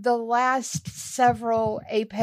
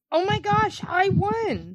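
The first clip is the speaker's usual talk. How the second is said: high thrilled squeal of victory; shrieking jackpot winner; 8 semitones higher than usual. Real, not acted. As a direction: squeal it like you hit the jackpot. Announcing she won her picks.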